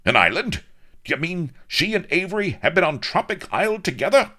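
loud, very high, incredulous admiral; violently startled. A narrator's reading. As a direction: splutter questions in rising outrage. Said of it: "Rook started violently".

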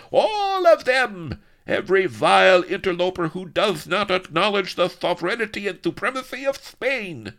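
high, loud tyrant's bellow; bawling. A narrator's reading. Don Lardo rages at every interloper, bawling. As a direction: bawl it out, drawing out the first words. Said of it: "bawled Don Lardo".

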